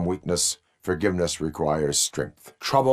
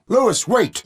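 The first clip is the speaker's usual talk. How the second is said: high, loud call to stop; urgent shout; 6 dB louder than usual. Acted. Officer calling to a partner to wait, high urgent shout. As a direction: shout after your partner.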